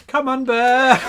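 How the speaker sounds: high, loud call to a bear. Pretend. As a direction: call it out of the cave. Calling the bear out.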